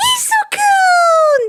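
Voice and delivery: very high, loud, drawn-out squeal of a name; delighted shout. Acted. Himiko seeing the hero call, high delighted squeal.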